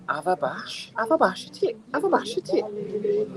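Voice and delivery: high-pitched, squeaky pleading; exasperated urging. Real, not acted. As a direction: urge them on in a strained high voice. Urging scooter riders to walk.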